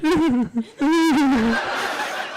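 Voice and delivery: high, badly faked wailing sobs; hammed-up boo-hoo, obviously put on; terrible fake crying. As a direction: whimper in a high fake cry. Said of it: "terrible acting job of crying".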